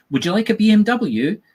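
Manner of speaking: high, loud eager offer; eager clerk. Retold or imitated. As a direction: sell it like a salesman.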